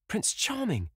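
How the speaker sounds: very high, thrilled exclamation; name your beloved with soaring excitement; excited. A narrator's reading. Sister sights her beloved passing.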